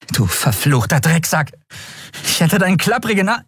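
high, angry outburst; furious cursing. A narrator's reading. Endriel insults gloating skeleton, angry high outburst. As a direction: curse the creature, speeding up until cut off. Enraged insult, cut off by companion.

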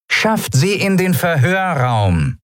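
high, slow captain's order; loudspeaker command. A narrator's reading. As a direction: issue the order over an intercom. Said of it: "rauschte eine vertraute Stimme aus einem Lautsprecher".

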